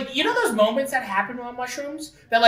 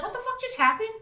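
high, softer voice of stunned disbelief; acted amazement; 12 semitones higher, 5 dB quieter than usual. Retelling friends stunned by a moment on shrooms, acted amazement. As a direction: squeak it out, baffled.

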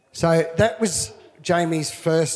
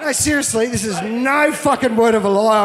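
usual speaking voice

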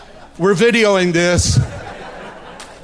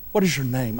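gentle asking; 10 semitones lower, 8 dB quieter than usual. Acted. Preacher imagines asking listeners gently.

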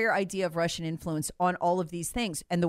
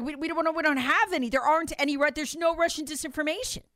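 higher-pitched, imitating flustered staff under pressure; retold near-panic, voice pitched up; mild mock panic; 7 semitones higher than usual. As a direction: protest as if mildly panicked, pitch raised. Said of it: "in kind of almost a panic".

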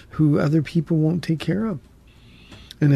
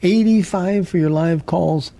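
bright on-air host voice, higher; radio host; 4 semitones higher than usual. Acted. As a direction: welcome listeners like a radio host. Host opens the call segment.

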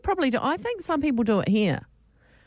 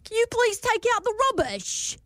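high put-on British accent; imitating an American going British; 13 semitones higher than usual. American asking his flatmate in a British accent. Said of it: "he said".

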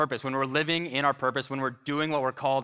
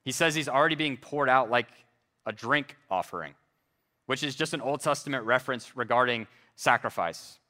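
usual speaking voice

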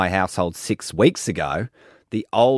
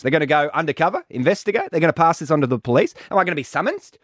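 high, hurried string of worried questions; anxious fretting; 8 semitones higher, faster than usual. Real, not acted. Host fretting over informing on his neighbour, anxious rapid questions. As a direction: spiral into mock-serious panic, pitch up. Frets about consequences of informing.

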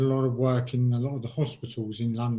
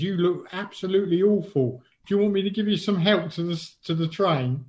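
higher-pitched imitation of a friendly Londoner; London accent; 6 semitones higher than usual. Homeless man offering the speaker help, London accent. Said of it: "in sort of his London accent".

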